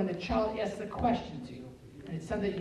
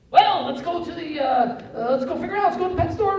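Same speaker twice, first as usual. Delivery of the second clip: high, loud, frantic parent; hammed-up overeager problem solver; flustered rescuing parent; 9 semitones higher, 9 dB louder than usual. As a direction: scramble for a fix, stumbling over the words. Acts flustered parent rushing to solve it.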